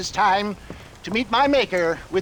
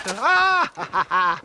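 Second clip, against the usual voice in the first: triumphant laugh; 3 dB louder, slower than usual. Acted. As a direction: cackle with delight at the find. Finds the item, triumphant.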